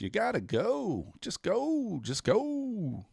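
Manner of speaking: very high, thin pleading voice; squeaky pleading. Pretend. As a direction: beg it in a tiny high squeak.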